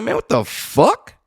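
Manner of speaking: can't believe what just happened; storyteller reliving his shock at the rudeness; outraged disbelief. Retold or imitated. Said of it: "And I'm like".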